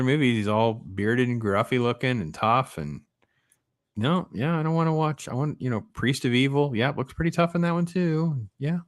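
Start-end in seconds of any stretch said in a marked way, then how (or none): none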